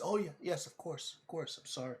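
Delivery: high-pitched hasty agreement, softening at the end; flustered princess. Acted. Princess reminded of the reward, high flustered apology.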